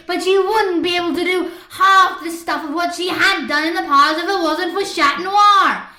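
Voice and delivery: loud, high, relentless angry tirade; on a roll. A narrator's reading. Marinette raging at the class about Chat Noir, loud. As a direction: keep hammering, don't let up. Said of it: "She was on a roll now".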